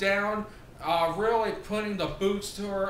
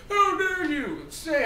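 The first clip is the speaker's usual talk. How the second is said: mock outraged yell; 9 semitones higher, 4 dB louder than usual. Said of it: "Bully Ray comes out there".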